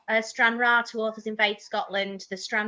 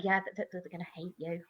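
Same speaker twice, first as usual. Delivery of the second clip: quiet agreement; 6 semitones lower, 13 dB quieter, faster than usual. Retold or imitated. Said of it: "she's like".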